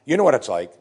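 usual speaking voice

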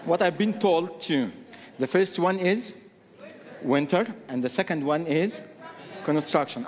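normal talking voice

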